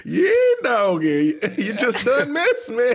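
grizzled miner laughing through; old prospector. Acted. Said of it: "Old prospector looking for gold".

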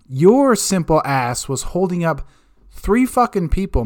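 usual speaking voice

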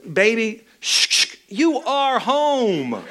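high, very drawn-out mock soothing; condescending hush. Husband to crying wife on the floor, mock-soothing put-down. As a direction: hush her, then stretch each word out high.

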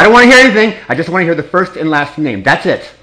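loud, exasperated cut-off; angry. Real, not acted. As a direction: snap back in frustration. Caller frustrated with pharmacy.

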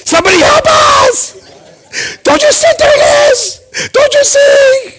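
very high, drawn-out cry for help; hammed-up panicked shriek. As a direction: wail for someone to step in. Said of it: "I'm panicking".

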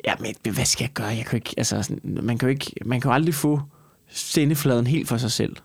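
usual speaking voice

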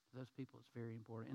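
soft repeat. Shown as a demonstration.

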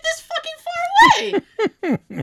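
high, slow laughing yell; high laughing mock protest; mock shout. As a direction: yell it out, exaggerating. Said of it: "I'm like".

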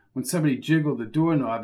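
ordinary talk, nothing marked

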